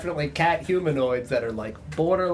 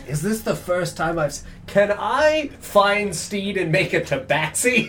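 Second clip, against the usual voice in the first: ordinary talk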